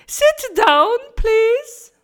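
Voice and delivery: put-on high, loud, English accent; cheery order. A narrator's reading. Simon telling the pupils to sit, high and loud. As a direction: sing out the order.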